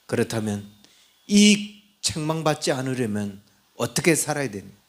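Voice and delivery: ordinary talk, nothing marked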